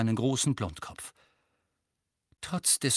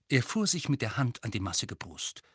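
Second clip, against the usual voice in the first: ordinary delivery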